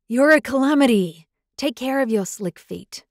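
loud, high outburst easing off; frustrated. A narrator's reading. Alpha scolding the girl who tripped him, frustrated.

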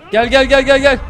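loud, high, urgent "gel, gel" shouted to the team; loud calling. Real, not acted. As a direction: yell "gel" to pull the squad over. Calling teammates during fight.

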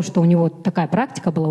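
normal talking voice

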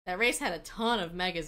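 ordinary talk, nothing marked

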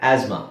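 ಆಸ್ಮಾ low, with Z for STH; Z sound. Shown as a demonstration. Teacher modelling English, ಆಸ್ಮಾ with a Z sound. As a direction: say ಆಸ್ಮಾ as az-ma, falling. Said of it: "ಝೆಡ್ use ಮಾಡ್ಬೇಕು".

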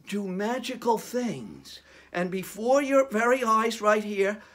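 ordinary delivery

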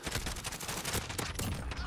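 long, soft, airy intake of breath; shocked gasp. Real, not acted. Player shocked at own kill, shocked gasp.

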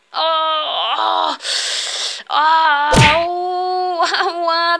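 hammed-up ow-ow groans; pain after crash. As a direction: yelp and groan from the fall.

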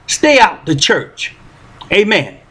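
ordinary talk

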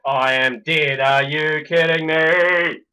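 loud, real frustrated disbelief; exasperated outburst. Real, not acted. Gamer who has just died in the game, loud and exasperated. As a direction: cry out in exasperation, building to the last word. Gamer exclaims at own death.

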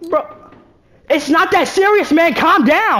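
high, loud exasperated plea; high-pitched frustrated pleading; exasperated protest. Real, not acted. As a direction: plead with the game to chill. Arguing with the game.

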